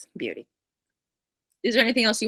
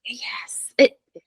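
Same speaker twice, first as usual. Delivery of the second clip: whispering; 4 semitones higher, much slower than usual. Real, not acted. Whispered agreement.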